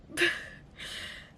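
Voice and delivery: breathy little chuckle; laugh. Real, not acted. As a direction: let a short laugh escape. Laughs about her chill parents.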